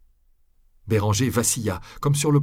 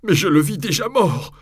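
tearful confession; 11 semitones higher, 5 dB louder than usual. A narrator's reading. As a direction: confess through tears. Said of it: "en fondant en larmes".

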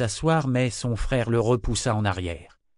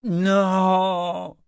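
high, drawn-out roar; long exasperated yell; 9 semitones higher, 4 dB louder than usual. A narrator's reading. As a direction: roar the word in frustration. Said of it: "rugit-il exaspéré".

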